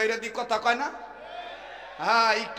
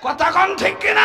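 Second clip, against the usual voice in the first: much higher shouted question; crowd call; 10 semitones higher, 7 dB louder than usual. Real, not acted. Preacher demanding agreement, shouting high. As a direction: rouse the crowd to answer.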